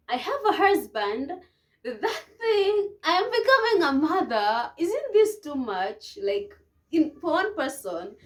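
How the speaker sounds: high, loud, exasperated outpouring; overwhelmed protest. Retold or imitated. New wife reliving her panic at motherhood, overwhelmed protest. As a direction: protest loudly as it all piles up.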